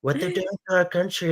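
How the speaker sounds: Trump-style rally riff, repetitive and emphatic; Trump impression. Mocking right-wing talking points in a Trump impression. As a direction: do Trump, bragging and outraged by turns.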